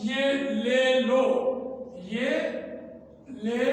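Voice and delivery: یہ لے لو slowly with Urdu e and o; drawn-out Urdu vowels as a bad example; slow یہ لے لو with Urdu e and o sounds; Urdu majhul vowels. Shown as a demonstration. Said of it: "یہ ساری اردو ہے".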